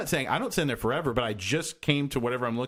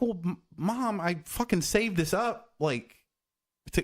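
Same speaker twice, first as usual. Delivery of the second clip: retorting to mom; 7 semitones higher, slower than usual. Retold or imitated. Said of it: "I'm like".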